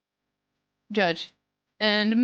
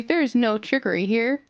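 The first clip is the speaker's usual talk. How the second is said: usual speaking voice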